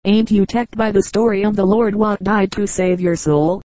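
ordinary delivery

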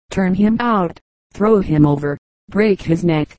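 plain reading voice

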